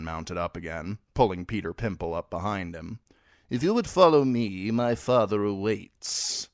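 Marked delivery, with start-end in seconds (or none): 3.5-6.5 a touch of stiff courtesy; mildly raised knightly voice; a hint of a knight. Sir Ryman voiced.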